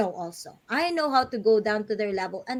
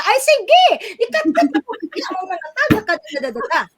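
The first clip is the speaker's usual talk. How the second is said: high, loud mock scolding mother; scolding Filipina mom; 9 semitones higher, 7 dB louder than usual. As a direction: snap at the fallen child, hammed up. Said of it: "Pero pag Pilipina talaga".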